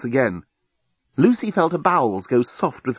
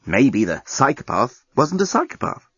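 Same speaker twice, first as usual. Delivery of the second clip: ordinary delivery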